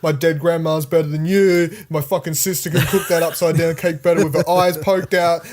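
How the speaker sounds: loud mocking rant; hammed-up furious critic; mock angry rant. Mock rant about bad cooking.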